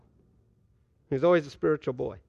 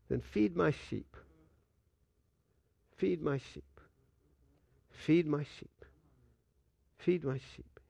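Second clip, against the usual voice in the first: soft instruction; 7 dB quieter than usual. Acted. The Lord instructs softly.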